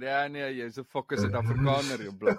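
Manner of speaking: put-on teasing South African accent, pitch jumping up at the end; a hint of a cheeky Joburg woman; switching to Afrikaans slang. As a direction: tease him in broad South African. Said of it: "she switched and said".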